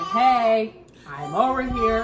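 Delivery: imitating the baby's call. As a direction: call out for attention like the baby. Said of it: "She just like".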